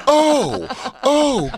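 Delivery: high falsetto shriek of alarm, mocking; mock panicked shriek. Friend hears about herpes, mock horrified shriek.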